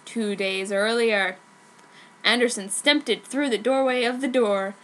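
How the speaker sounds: usual speaking voice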